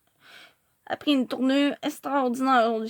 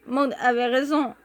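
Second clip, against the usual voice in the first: plain reading voice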